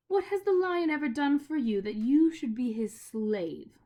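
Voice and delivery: mock the lion's hold on him; witch voice. A narrator's reading. Witch challenging Diggory's loyalty, scornful.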